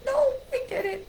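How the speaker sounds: high, laughing squeal; amused denial. Real, not acted. Storyteller on being pulled over by police, amused denial. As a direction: answer yourself in a high, giggly voice. Amused answer to own rhetorical question.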